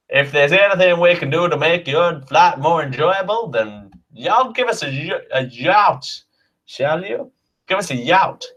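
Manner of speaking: hammed-up Southern US air hostess safety briefing; a mock Southern US flight attendant walking through safety; Southern US accent. Air hostess briefs passengers in a hammed-up Southern US accent. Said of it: "could you imagine me going".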